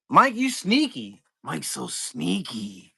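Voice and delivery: high, slow teasing sing-song; playful teasing. Real, not acted. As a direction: tease him like a sly friend. Teasing a buyer.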